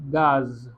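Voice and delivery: गाज with a hard G, said clearly; French word gaz. Shown as a demonstration. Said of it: "इसको pronounce करते हैं". (गाज का फ्रेंच उच्चारण)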